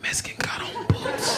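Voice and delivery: whispered, passing it along; hushed secret warning. Retold or imitated. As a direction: whisper it like a password. Passing the warning to someone else.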